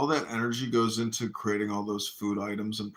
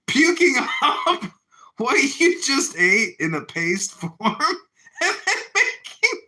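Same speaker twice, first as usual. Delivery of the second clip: much higher and louder, breaking into laughter; laughing through it; 7 dB louder than usual. Real, not acted. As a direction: crack up while describing something gross. Cracking up over puke nuggets.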